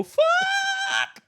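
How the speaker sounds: high panicked shout; panicked kids. Retold or imitated. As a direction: yell it in sudden terror.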